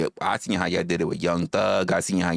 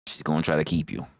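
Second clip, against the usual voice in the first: said low and heavy, lower than her usual voice; low take; 6 semitones lower, faster than usual. Acted. A low warning to the wrestler. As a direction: drop the pitch on try. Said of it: "repeats line in new voice".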